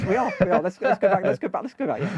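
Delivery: high and loud, hurrying everyone along; cheerfully herd the group back on track; eager rallying call. Real, not acted. Host urging the panel back to the scripts, high and bright.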